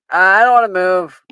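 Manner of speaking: loud refusal from across the room; yelled back at the classmate; yelling back. Acted. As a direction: call it back, stubborn and loud. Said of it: "She yells back".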